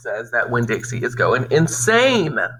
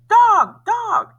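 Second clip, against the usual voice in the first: much higher, loud squawking scream; 7 dB louder than usual. Acted. Parrot Gertrude keeps screaming at the dog, shrill scream. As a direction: shriek it over and over like a panicked bird. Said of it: "Gertrude kept screaming".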